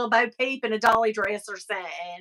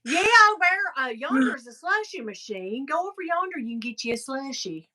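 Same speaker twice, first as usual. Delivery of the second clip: mock Southern clerk. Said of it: "They should have said".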